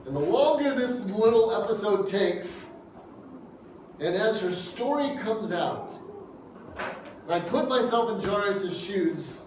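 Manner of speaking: ordinary delivery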